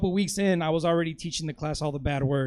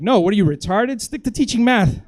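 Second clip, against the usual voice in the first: louder, raised-pitch scolding; mock scornful comeback; 4 semitones higher, 8 dB louder than usual. Student paying back the professor's insult, mock scornful. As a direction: throw the insult back with acted outrage.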